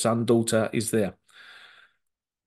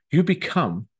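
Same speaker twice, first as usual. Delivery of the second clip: ordinary delivery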